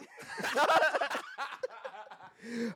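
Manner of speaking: high burst of laughter. Real, not acted. Laughing at his own joke, high laugh. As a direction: crack up.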